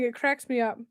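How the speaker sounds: ordinary talk, nothing marked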